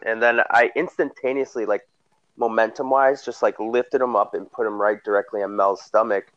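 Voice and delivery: ordinary delivery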